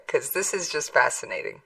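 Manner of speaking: ordinary talk, nothing marked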